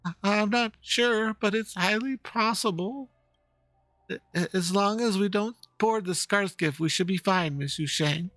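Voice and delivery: high, slow, stuttering scared voice; nervous high stammer; stammering fear. Acted. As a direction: stutter through it like a timid worker. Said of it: "L-, l-, let".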